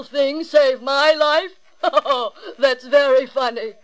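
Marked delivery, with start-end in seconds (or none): 1.8-2.5 big scornful laugh; hearty lion laugh. Acted.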